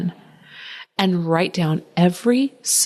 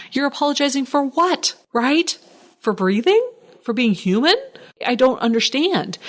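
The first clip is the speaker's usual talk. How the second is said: exasperated disbelief; 6 semitones higher than usual. Real, not acted. Ranting about needless apologies.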